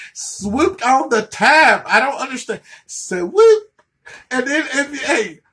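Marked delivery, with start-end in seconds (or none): 2.9-3.7 high stretched swoop; mock swoop. Mocking the hairdo, high rising swoop. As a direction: squeal it out high.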